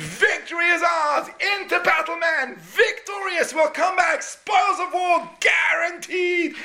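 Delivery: battle scream. Retold or imitated. General sending men into battle, screaming high. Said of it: "everyone screams".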